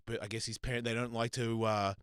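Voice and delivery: ordinary talk, nothing marked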